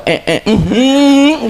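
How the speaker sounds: high, slow grunts of disgust; disgusted sniff sounds. Retold or imitated. Mother imitated reacting to her baby's dirty nappy with high disgusted grunts. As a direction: hum it like a whiff hit you.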